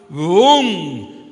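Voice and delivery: ordinary delivery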